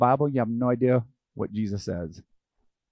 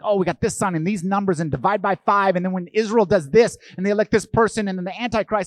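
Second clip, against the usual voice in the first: higher, louder excited rattling; eager prophecy decoder; 8 semitones higher, 5 dB louder than usual. Retold or imitated. Preacher mocks a church excitedly predicting the end times. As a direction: pile up the clues with mock eagerness. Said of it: "any church that's like".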